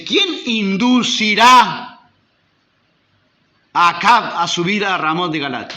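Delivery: slow, loud solemn question; voice of God. Acted. God asking the heavenly council, slow and loud. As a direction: ask it with divine weight. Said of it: "Dios preguntó".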